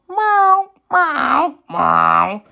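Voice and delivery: high falling meow meow meow; high cat meows; mewing cat. A narrator's reading. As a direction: mew like a little cat. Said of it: "mewed the cat".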